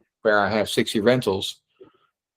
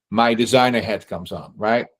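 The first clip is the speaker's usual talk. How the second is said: usual speaking voice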